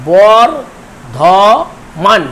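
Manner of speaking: very slow Bardhaman, broken as বর-ধ-মান with gaps; syllable by syllable. Shown as a demonstration. Teacher dividing the word for students, syllable by syllable. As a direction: draw out Bardhaman in three separate beats. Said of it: "এখানে তিনটি দল আছে".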